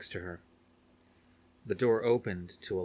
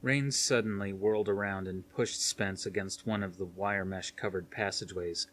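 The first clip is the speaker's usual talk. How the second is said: ordinary delivery, nothing marked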